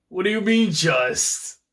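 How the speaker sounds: high, indignant disbelief. Real, not acted. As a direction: shoot back in disbelief. Indignant reply to dialogue.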